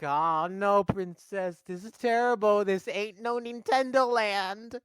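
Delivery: hammed-up melodramatic lament; Luigi. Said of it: "And Luigi's like".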